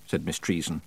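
ordinary delivery

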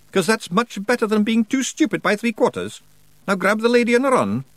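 high, loud Scots brogue; cheeky Feegle quip; Scottish Feegle voice. Acted. Rob Anybody speaking.

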